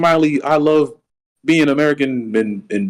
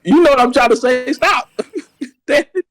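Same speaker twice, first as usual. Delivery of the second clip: higher, laughing and flustered; 10 semitones higher than usual. Real, not acted. Host caught by cohost's teasing, flustered laugh. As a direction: crack up while trying to protest. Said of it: "You got me all flustered".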